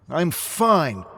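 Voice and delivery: loud, high defensive shout; defensive outburst. Acted. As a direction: insist as you storm off. Drunk David storms off.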